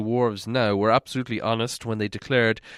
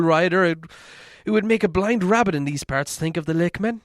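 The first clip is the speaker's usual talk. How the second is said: worried hobbit voice, raised higher; fretful hobbit fearing he said too much; Bilbo; 5 semitones higher, 3 dB louder than usual. Acted. Hobbit telling dwarves his worry, in a fretful higher voice.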